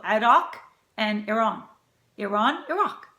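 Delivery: Iraq and Iran as ih-RAHK, ih-RAHN; correct pronunciation. Shown as a demonstration. Cook summing up both countries, properly pronounced. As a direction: say Iraq, Iran with ah, never eye. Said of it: "Simple as that".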